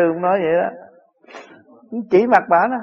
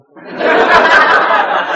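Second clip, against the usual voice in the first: burst of laughter; 7 dB louder than usual. Real, not acted. Laughing at own joke.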